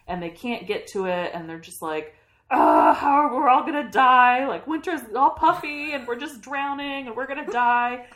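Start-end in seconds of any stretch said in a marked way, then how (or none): 2.5-8.1 higher, louder, frantic doom wail; mock panic. Friends in a storm-tossed boat wailing in mock panic.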